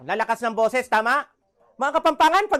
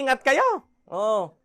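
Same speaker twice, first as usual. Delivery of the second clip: ordinary talk, nothing marked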